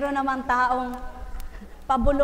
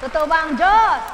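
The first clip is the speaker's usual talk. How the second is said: loud climax; 3 semitones higher, 8 dB louder than usual. Real, not acted. Preacher to congregation, loud climactic question. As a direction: build up to a strong call. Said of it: "building the same question".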